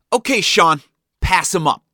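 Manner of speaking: loud, high bark; barked order. A narrator's reading. Dad ordering Sean from the wheel, barking. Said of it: "Dad barked".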